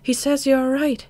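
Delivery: smiling daughter tells mother she's well. Acted. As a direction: say it with a smile to mother. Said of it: "smiling from my heart".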